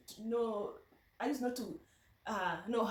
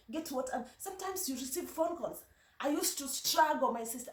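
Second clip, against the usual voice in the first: ordinary talk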